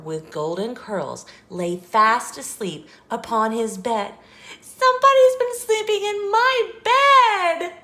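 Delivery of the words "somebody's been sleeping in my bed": very high, loud squeaky cry; Baby Bear's squeaky shriek. A narrator's reading. Baby Bear finds a girl in his bed, loud squeak. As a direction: shriek it like an outraged tiny cub. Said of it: "Baby Bear's high, squeaky voice".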